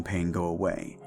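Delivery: usual speaking voice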